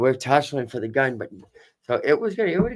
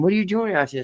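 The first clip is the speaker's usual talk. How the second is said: higher imitated girl's question; girl at the club; 7 semitones higher, faster than usual. Girl asks the out-of-towner what brings him, higher imitated voice. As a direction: mimic her curious question, pitched up. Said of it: "So she's like".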